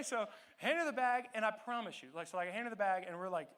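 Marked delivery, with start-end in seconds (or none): none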